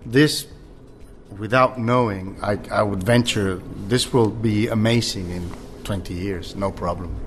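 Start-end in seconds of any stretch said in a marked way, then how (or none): none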